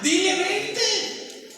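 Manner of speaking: high, slow egging-on voice; mock friend's advice. Friends telling the wife to yell back at her husband, mock high and slow. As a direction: ham up a gossiping friend urging her on. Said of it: "¿qué dicen las amigas".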